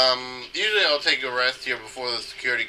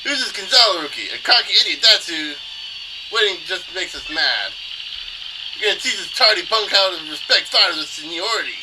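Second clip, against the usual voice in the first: shaky try at a Brooklyn tough guy; cocky, raised attempt at Brooklyn accent; 6 semitones higher, 3 dB louder than usual. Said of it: "I can't do a Brooklyn accent".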